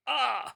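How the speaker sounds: high falling yelp, acted; acted cry of pain. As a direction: yelp as the whip lands. Bandit cries out when whipped. (कोड़े की मार पर ऊँची चीख)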